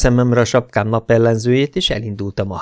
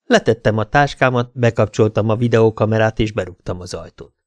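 ordinary delivery

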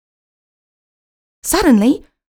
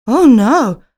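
dismayed cry; 3 semitones higher, 8 dB louder than usual. A narrator's reading. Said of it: "cries Pinky on the shelf".

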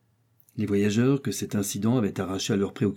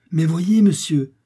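higher, louder, insistent call; urgent exclamation; 7 semitones higher, 6 dB louder, slower than usual. Acted. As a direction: urge the master to look. Joe urgently points out the balloon.

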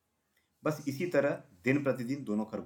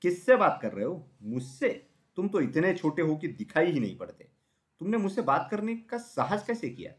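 high-pitched arrogant indignation; affronted, puffed-up elephant; haughty; 6 semitones higher than usual. A narrator's reading. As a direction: look down on a tiny nobody. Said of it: "अकड़ कर बोला".

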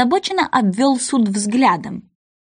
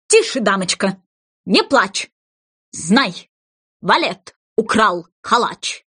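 lightly voiced herald, slower; herald's reply; slower than usual. Second half of verse.

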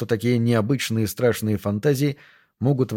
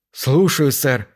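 mild bark of obedience; crisp shouted acknowledgement; 2 semitones higher, much slower than usual. A narrator's reading. Subordinate acknowledges the captain's order, calling out. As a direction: call it out like a keen subordinate. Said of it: "выкрикнул Нойс".

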